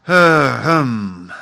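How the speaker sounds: loud, drawn-out ahem; throat-clearing ahem. A narrator's reading. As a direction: clear the throat pointedly before speaking. Father clearing his throat in story.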